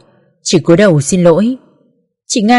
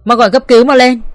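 rising, louder urgent order; urgent call; 4 semitones higher, 3 dB louder than usual. Acted. Daughter urging her brother to call an ambulance, urgently loud. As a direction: hurry him to call an ambulance. Urging help after mother falls.

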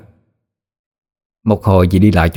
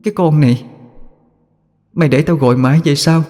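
put-on ghostly child calling; 6 semitones higher than usual. A narrator's reading. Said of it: "tiếng của nhỏ Giang".

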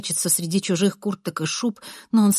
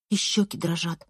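plain reading voice

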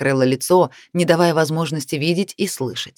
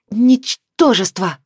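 slow, loud, high furious insult; furious hiss; 5 semitones higher, 3 dB louder, much slower than usual. A narrator's reading. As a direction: hiss it out in fury. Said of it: "с яростью процедила Сиена".

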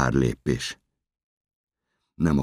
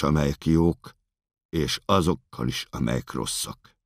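ordinary talk, nothing marked

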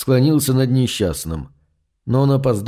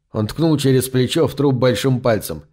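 plain reading voice

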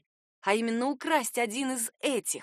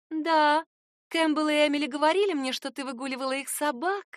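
statement lifted into a question on «собак»; raised pitch, «собак» left hanging like a question; questioning intonation; 6 semitones higher than usual. A narrator's reading. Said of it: "произносит это с вопросительной интонацией". (утверждение с вопросительной интонацией на слове «собак»)

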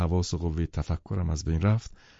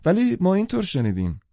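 much higher, pressing the point; insist, won't let it drop; insistent; 11 semitones higher, 4 dB louder than usual. Acted. Daisy insisting to Nick, high and pressing. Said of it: "دیزی با اصرار پرسید".

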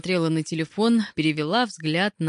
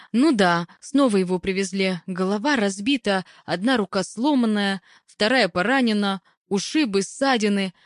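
higher-pitched, eager reporting voice; lightly voiced female caller; hospital caller; 4 semitones higher than usual. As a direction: rattle off a list of worrying news. Caller lists patient's injuries.

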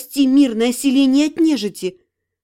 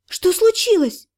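alarmed question; 6 semitones higher than usual. Acted. She asks what happened.

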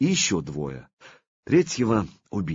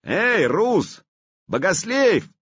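calling out; 12 semitones higher, 4 dB louder than usual. Acted. Someone calls out to him.

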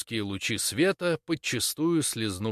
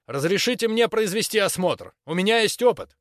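urgent loud plea; 7 semitones higher, 4 dB louder than usual. Acted. Boy pleads loudly.

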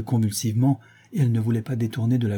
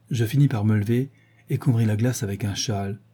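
usual speaking voice

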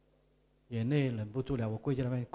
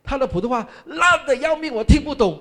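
mocking complaint; 11 semitones higher, 9 dB louder than usual. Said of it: "他讲".

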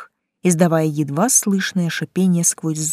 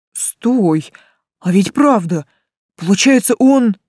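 excited clamor; 3 semitones higher, 5 dB louder, slower than usual. A narrator's reading. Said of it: "загалдели парни".

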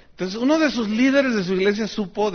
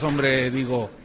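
ordinary talk

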